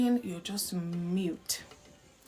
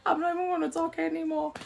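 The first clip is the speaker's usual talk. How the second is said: exasperated, higher, quickening at the end; fed-up spouse; 5 semitones higher than usual. Retold or imitated. Spouse quitting an argument, exasperated and higher. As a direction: huff it out like a spouse done arguing. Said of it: "I mean, like".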